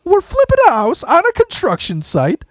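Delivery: very high, tight, throat closing with anger; like a huge wrestler squeaking with fury; high strangled rage. Retold or imitated. Imitating his boss scolding him, shrill strangled anger. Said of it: "his throat would close up with rage".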